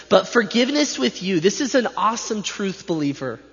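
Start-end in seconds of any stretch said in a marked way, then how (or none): 0.1-1.4 "But forgiveness with you" pitched up emphatically; emphatic. Shown as a demonstration. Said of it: "It's emphatic, it's definite, it's present".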